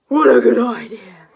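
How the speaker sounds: loud, lightly voiced eager dragon; Zog the dragon. Zog eagerly accepting the girl's offer, loud. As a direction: answer like a keen young dragon. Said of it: "said Zog".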